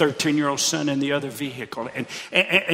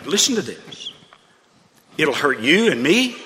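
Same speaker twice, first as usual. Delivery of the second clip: ordinary talk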